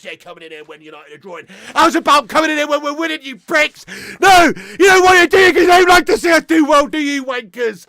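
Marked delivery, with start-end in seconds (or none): 1.7-7.8 high, loud mocking yell; gloating shout. Real, not acted. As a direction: shout down the rival fans. Taunting rival fans in chat.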